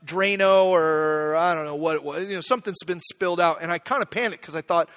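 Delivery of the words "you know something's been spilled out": ordinary talk, nothing marked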